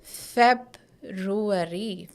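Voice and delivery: February stretched out very slowly, syllable by syllable. Shown as a demonstration. Teacher modelling February slowly for students. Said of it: "This is".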